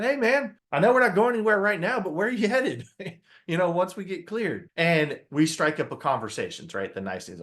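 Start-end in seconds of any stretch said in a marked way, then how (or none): 0.0-4.7 high, bright and fast, chatting up a stranger; cheerful hello to the guy in the next seat; friendly greeting. Retold or imitated. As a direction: break the ice with an upbeat stranger's hello.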